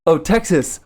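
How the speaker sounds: mock finger-gun enthusiasm; imitating foreign locals. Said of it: "And they go".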